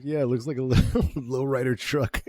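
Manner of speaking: high, loud imitation breaking into laughter; amused mock quote. Host jokes about the leprechaun's truck, amused and laughing. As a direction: crack up while voicing the character.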